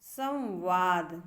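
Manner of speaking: संवाद slow, the म clearly said as सम्वाद; drawn-out संवाद with a full म sound; imitating Krishna's pronunciation. Teacher imitates Krishna from the TV show, slowly. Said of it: "देखो ऐसे बोलते थे".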